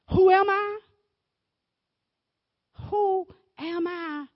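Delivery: loud, high cry; shouted question; preacher's cry. Acted. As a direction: call it out to the room.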